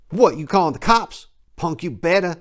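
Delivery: a touch of the pimp taunting the punk. Retold or imitated. Pimp holding a gun on the clerk, mild mock taunt.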